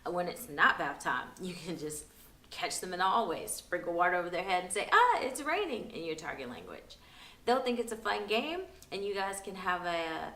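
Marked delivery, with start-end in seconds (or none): 4.9-5.9 high, loud mock surprise; playful surprised cry. Parent catching a child in the hallway with water, playful surprised cry.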